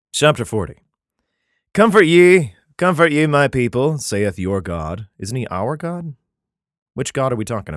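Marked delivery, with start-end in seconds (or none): 1.7-5.1 solemn, measured Bible reading; declaiming scripture, slowing at the end. Acted. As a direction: read it like a King James passage. Reads Isaiah aloud.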